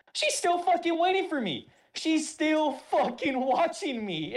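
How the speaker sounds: high, loud and drawn out; dramatic. Retold or imitated. Telling how the drill sergeant stared, high and slow. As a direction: pile on the drama, stretching it.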